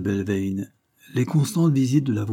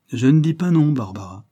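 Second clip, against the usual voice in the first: playful admission; 5 semitones higher, 6 dB louder than usual. A narrator's reading. Lawyer hints at a chosen wife.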